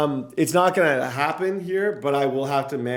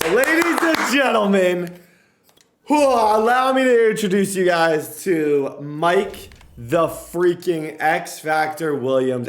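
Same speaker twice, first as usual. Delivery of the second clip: big, slow arena announcer intro; playful grand introduction, starting high and loud; hyped announcer; 5 semitones higher, 6 dB louder, slower than usual. Pretend. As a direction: boom it out like a ring announcer, stretched and loud. Mock announcer introducing his player.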